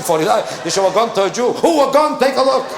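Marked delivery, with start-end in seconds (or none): 1.5-2.7 high startled squawk at the gun; mock alarm. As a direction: recoil in mock horror.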